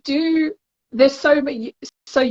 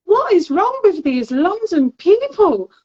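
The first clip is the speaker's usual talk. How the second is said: exasperated; 9 semitones higher than usual. Retold or imitated. Exasperated retelling.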